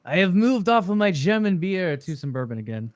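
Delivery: mock Russian accent, slightly German-sounding; attempted Russian accent. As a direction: put on a shaky-quality fake Russian accent. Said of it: "That was my Russian accent".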